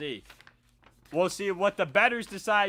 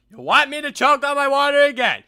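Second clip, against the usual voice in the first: mock choking voice; 8 semitones higher, 12 dB louder than usual. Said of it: "another impersonation".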